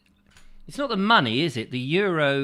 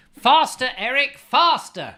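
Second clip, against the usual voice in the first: shouting; 11 semitones higher, 7 dB louder, slower than usual. Pretend. Urging Eric on treadmill.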